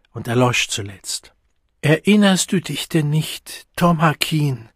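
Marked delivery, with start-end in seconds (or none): 1.8-4.7 shaky, fearful plea; trembling voice. A narrator's reading. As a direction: voice quivering with dread. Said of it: "mit bebender Stimme".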